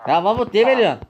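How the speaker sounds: high, loud indignant protest; outraged at the betrayal; öfkeli itiraz. Acted. Ilacı verdiği için isyan. (Mahmut'a öfkeyle itiraz)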